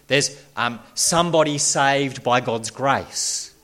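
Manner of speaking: ordinary delivery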